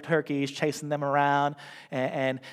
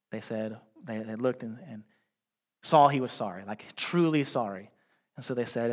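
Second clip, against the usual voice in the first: normal talking voice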